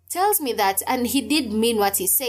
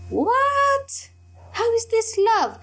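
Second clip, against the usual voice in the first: high, stunned outburst of disbelief; shocked disbelief; 13 semitones higher, much slower than usual. Retold or imitated. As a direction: cry out in sudden shocked confusion. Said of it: "I'm saying it internally in my thoughts".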